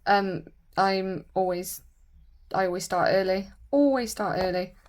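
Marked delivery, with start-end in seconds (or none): none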